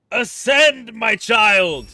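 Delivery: booming, grandiose decree; grand proclamation. Pretend. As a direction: proclaim it like a dramatic prophet. Levelling up the cat.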